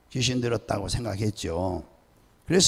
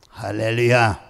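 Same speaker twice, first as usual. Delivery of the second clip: ordinary talk, nothing marked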